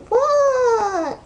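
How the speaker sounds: high, loud drawn-out "what"; exaggerated high-pitched yell, imitating a friend; mock screech. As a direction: screech it like an irritated, overdramatic friend. Retold snap from friend in bathroom.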